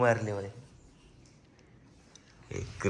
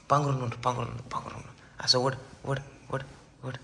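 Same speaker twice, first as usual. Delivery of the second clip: normal talking voice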